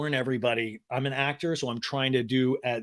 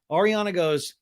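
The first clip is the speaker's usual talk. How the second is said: normal talking voice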